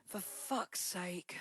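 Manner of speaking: breathy stage whisper of the show title; slow, drawn-out whispered title. Acted. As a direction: hush it like a secret.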